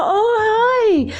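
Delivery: happy hello. Retold or imitated. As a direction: squeal it like he's thrilled to see you. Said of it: "he's like".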